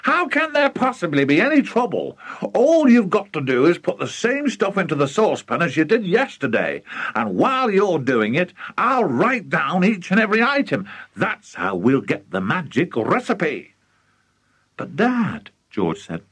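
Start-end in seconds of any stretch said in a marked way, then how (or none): 0.0-13.8 high, eager rattle of plans; carried away by his own scheme; excited father. Acted. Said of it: "Mister Cranky was too excited". 14.8-15.5 put-on high-pitched boy's tentative plea; mild, high protest; pleading. George first tries to interrupt.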